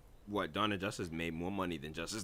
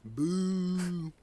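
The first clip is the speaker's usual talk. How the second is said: booing; 9 semitones higher, 5 dB louder than usual. Real, not acted. Booing again.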